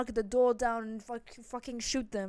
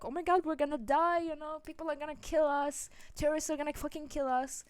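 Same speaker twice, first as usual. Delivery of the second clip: higher-pitched imitation of panicked neighbors; retold alarm, raised pitch; mock panic; 6 semitones higher than usual. Neighbors fearing terrorists came to kill them, mock panic.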